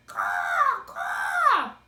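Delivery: very loud, very high crow caws; crow screaming. A narrator's reading. Big crow screaming at Edward, very loud and high. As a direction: shriek like an angry crow. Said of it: "said Pellegrina the crow".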